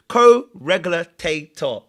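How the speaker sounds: "co-regulator" drawn out very slowly, each syllable spelled out; slow careful pronunciation. Shown as a demonstration. Slowly sounding out "co-regulator" for viewers once he finally gets it. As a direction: land every syllable of "co-regulator" at half speed. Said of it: "That's it, a co".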